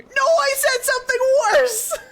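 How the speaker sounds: high, loud squeal of laughter through the words; laughing high cry. Real, not acted. Confessing to the co-host what she said, high laughing squeal. Laughing-crying retelling to chat.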